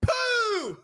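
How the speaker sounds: put-on high kid voice calling out Pooh; dad imitating his son greeting the co-host. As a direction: imitate a little boy calling out. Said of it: "he goes".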